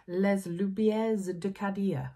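slow, careful try at a French accent; French accent, deliberately slowed; attempted French accent. Shown as a demonstration. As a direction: have a go at French, drawn out. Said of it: "try and say it in a French accent".

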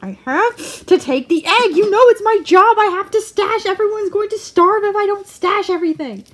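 loud, high, hammed-up protest; mock frantic rat. Rat defending egg stash, loud hammed-up desperation. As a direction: play a panicking hoarder, high and loud. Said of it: "He's like".

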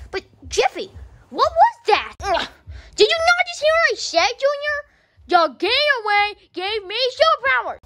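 squeaky high voice, shouting in panic; high-pitched yelling kid; high cartoon kid voice. Character voice.

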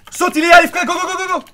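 yelling high and loud at teammate; frantic shouting. Real, not acted. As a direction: shout them into jumping. Urging teammate to jump now.

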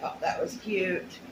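ordinary delivery

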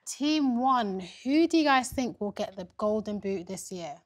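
ordinary talk

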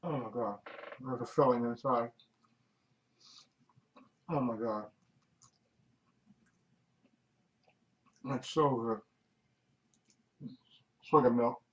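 chewing while talking; eating a cupcake; mouth full. Real, not acted. Seen 0: Bites cupcake at -5, holds bitten cupcake, seems chewing while talking.

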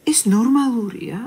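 appalled disbelief. Acted. Woman questioning her own thoughts of a relative's death, appalled. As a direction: question yourself, slowly and loudly, in disbelief.

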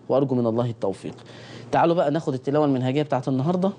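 ordinary delivery

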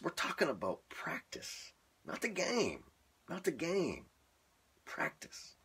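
high-pitched, hammed-up quote of the rant; mock practice rant. Joking to viewers with a mock practice rant. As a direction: parody the famous practice speech. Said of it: "quoting famous practice rant".

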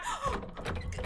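high frightened gasp; sharp gasp. Real, not acted. Gamer gasping as the monster appears.

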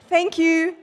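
high, loud, flustered thanks; embarrassed gratitude. Retold or imitated. Patient to lady who paid, embarrassed thanks. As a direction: gush it, not knowing what to do.

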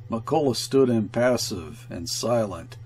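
ordinary delivery, nothing marked

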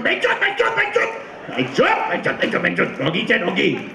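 high-pitched imitation of cursing drivers; mock impatient swearing from the car behind; Punjabi drivers swearing. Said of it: "behind the Punjabi Sharmas are going".

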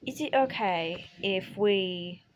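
halting mock Australian mixed with British; Australian-British accent. Asking co-player about the video's accent, in halting Australian-British. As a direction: feel your way through a muddled British-Australian accent. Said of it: "an Australian mixed with British".

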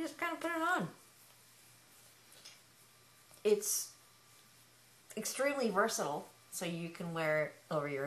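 muffled behind fabric; speaking through covered mouth; through a knit balaclava. Real, not acted. Seen 0: Knit balaclava covers mouth until pulled down around 3.2 s.

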